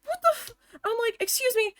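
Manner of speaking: high-pitched, cut-off startled outburst; jolted by fright, voice shooting way up. Retold or imitated. Worker startled as the locked door slams open, startled. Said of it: "scared the fucking shit out of me".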